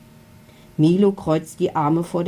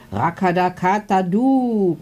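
calling out; 2 semitones higher, 3 dB louder than usual. A narrator's reading. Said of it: "ruft Milu".

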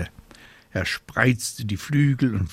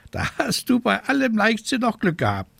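high, fussy scolding raven voice; 14 semitones higher, faster than usual. A narrator's reading. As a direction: play a worried, scolding raven. Raven sums up.

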